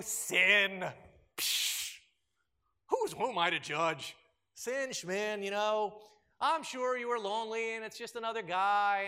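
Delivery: loud, breezy, dismissive mockery; hammed-up anything-goes counselor; mock permissive Jesus. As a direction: parody a shrugging pushover. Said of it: "Jesus doesn't say to the woman".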